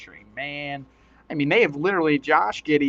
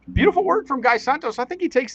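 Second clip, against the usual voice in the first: much higher, louder broadcast praise; excited praise; 10 semitones higher, 7 dB louder than usual. Real, not acted. Commentator hyping Guy Santos's quarter, high and loud. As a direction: lift the whole line up and out with delight.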